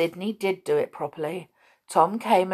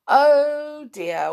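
gasp, then high "oh dear"; dismayed; 8 semitones higher, 5 dB louder than usual. A narrator's reading. Bear learning of Amelia Jane's trick, dismayed. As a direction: gasp at being fooled.

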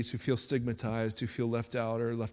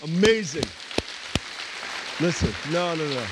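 louder, higher burst of delight; excited praise; 3 semitones higher, 4 dB louder than usual. Real, not acted. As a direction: cheer her on, thrilled. Pastor reacts after testimony.

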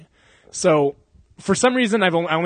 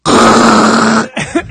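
imitated grinding noise; 6 semitones higher, 12 dB louder than usual. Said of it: "you hear, like, a".

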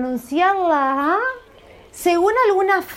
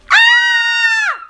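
piercing high, loud scared scream; sudden terrified shriek; scream of fright; 6 dB louder than usual. Shown as a demonstration. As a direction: shriek as if grabbed from behind. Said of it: "¿cómo es el grito de".

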